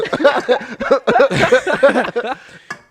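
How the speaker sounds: loud high burst of laughter; big laugh. Real, not acted. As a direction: cracks up loudly.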